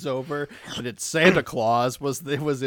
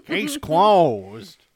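hard-boiled detective sign-off; noir detective; 4 semitones higher, 7 dB louder than usual. Retold or imitated. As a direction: clip it like a pulp gumshoe. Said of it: "like you're a pulp detective".

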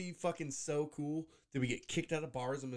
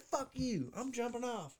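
higher-pitched imitation of gleeful defiance; mock defiance; 8 semitones higher, slower than usual. Host retelling Boone's leap before the crowd, mock defiant. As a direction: throw it out like a hammed-up rebel.

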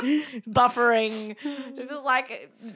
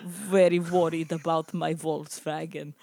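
bad Russian accent; 6 semitones lower than usual. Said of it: "this is not very good accent".

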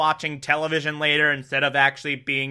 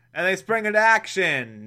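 usual speaking voice

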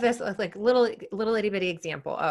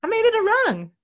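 much higher, louder mock cheer; excited cheer; 7 dB louder than usual. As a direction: squeal it like a tiny victory.

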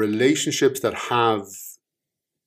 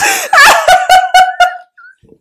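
loud laugh; 15 dB louder than usual. Real, not acted. Reader breaks into laughter.

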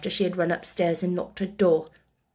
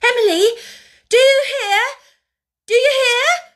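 high, loud desperate cry; crying out wildly; 9 dB louder than usual. A narrator's reading. Girl crying out to her doll, wild and loud. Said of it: "cried out wildly".

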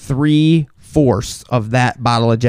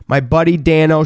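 ordinary talk

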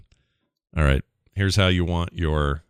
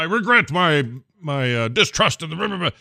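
lightly mocked stage-actor delivery; higher-pitched, halting theatrical recital; Shakespearean; 8 semitones higher than usual. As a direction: put on some Shakespearean weight. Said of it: "he was really going for that".

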